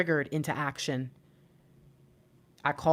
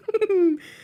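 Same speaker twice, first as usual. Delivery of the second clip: high laugh; 6 dB louder than usual. Real, not acted. Laughs after stalker joke.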